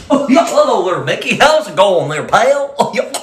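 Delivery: low dopey cartoon dog voice; goofy cartoon voice. Cartoon dog voice greeting Mickey.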